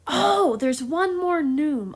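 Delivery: sudden delighted "oh", then stretch the words; geeking out. Real, not acted. Teacher remembers a favorite neume, geeking out. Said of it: "I'm super geeking out".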